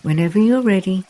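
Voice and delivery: usual speaking voice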